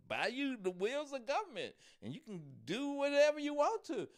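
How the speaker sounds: high-pitched hammed-up sales pitch; emphatic urging. Woman urges buying the government, high and emphatic. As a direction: urge him on, pitch climbing high. Woman advises man in skit.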